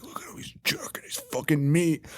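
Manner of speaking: hushed mocking whisper. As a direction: whisper it like a gross joke. Boyfriend mocking kid.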